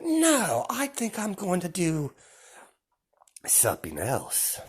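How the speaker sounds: high, slow, taunting villain voice; menacing villain Deku. Acted. Deku telling the villains they angered his boss, high and taunting. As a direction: lord it over them, savouring each threat.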